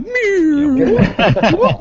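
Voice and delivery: high squeaky cat meow. Pretend. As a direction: meow like a squeezed credit card. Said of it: "the sound of James Spann's credit card".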